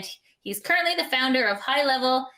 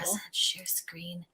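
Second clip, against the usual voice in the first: slow, unvoiced murmur; very soft, absent-minded whisper; whispered to self; 4 semitones lower, 10 dB quieter, much slower than usual. Real, not acted. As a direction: read the button under your breath. Reading screen prompts to self.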